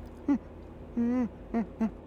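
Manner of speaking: high excited chimp hoots; a monkey whooping in the car; chimp screech. Acted. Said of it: "jumping around and screeching".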